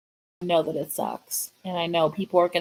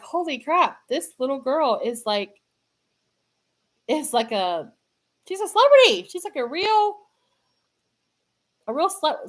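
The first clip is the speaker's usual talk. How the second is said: retold gasp of amazement, much higher; amazed retelling; 6 semitones higher, 4 dB louder than usual. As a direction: replay the wow moment, voice climbing high. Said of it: "I was like".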